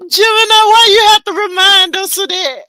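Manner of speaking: loud, exasperated laughing call-out; laughing shout. Real, not acted. Loud laughing call-out to Gemini over his comment. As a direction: holler at him through laughter.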